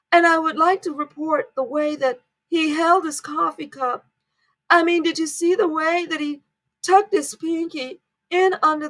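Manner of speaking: mock the breathless royal fan praising trivia; gushing TV host. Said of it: "I hear people talking like that".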